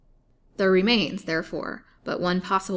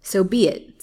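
loud, angry bark; louder furious reply; 10 dB louder than usual. A narrator's reading. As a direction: spit it out in fury. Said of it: "said Colville angrily".

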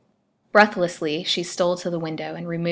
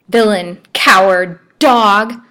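loud, ringing denunciation; passionate outrage; 4 semitones higher, 13 dB louder, slower than usual. A narrator's reading. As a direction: hurl each insult louder than usual.

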